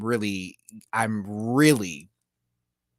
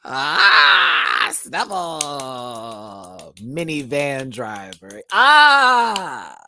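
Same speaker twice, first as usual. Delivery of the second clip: mock lip sync cries; 8 dB louder, much slower than usual. Mimicking a lip sync moment.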